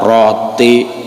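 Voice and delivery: usual speaking voice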